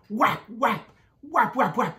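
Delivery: stamp out the pigs' hooves; galloping sound. A narrator's reading. Cochons qui s'enfuient. (onomatopée aiguë des sabots qui filent)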